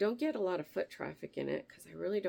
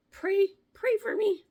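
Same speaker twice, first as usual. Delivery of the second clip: pleading; 8 dB louder than usual. Real, not acted. Half-joking plea to viewers.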